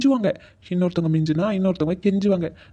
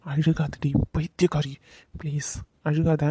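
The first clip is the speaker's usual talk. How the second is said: low, slow affectionate coaxing; tender soothing; 4 semitones lower, 4 dB quieter, slower than usual. Acted. Man begging his partner not to cry, tender and slow. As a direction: gently hush the crying, slowly.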